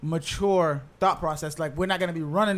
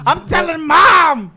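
put-on high, loud, slow whiny kid; whiny tattling little sibling; 12 semitones higher, 14 dB louder, much slower than usual. Mocking childhood tattling.

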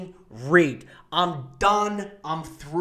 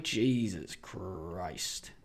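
much lower, softer and very slow; dragged-out groan; 12 semitones lower, 11 dB quieter, much slower than usual. Acted. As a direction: let it sink in disbelief. Exasperated aside.

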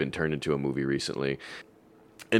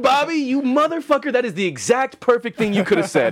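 much higher, louder, laughing through it; laughing delight; 4 dB louder than usual. Real, not acted. Retelling reaction to Bobby's perfect reply, laughing loud. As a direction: crack up in admiration.

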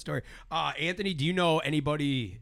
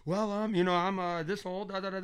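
higher voice through a barely open mouth; hiding his teeth; 7 semitones higher than usual. Retold or imitated. Imitating a sitcom man on a date, hiding his teeth. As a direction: mumble guardedly, higher, keeping teeth covered. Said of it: "he's, like, holding it like this".

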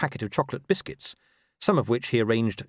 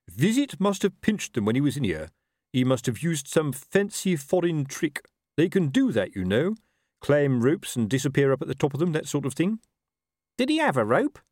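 lightly voiced, higher-pitched underling; excuse-making corporal; 5 semitones higher than usual. A narrator's reading. Corporal explaining missing sugar to his captain, excuse-making. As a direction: offer excuses in a raised character pitch.

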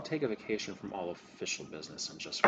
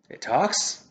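startled; 9 semitones higher, 15 dB louder than usual. Acted. NPC startled by item.

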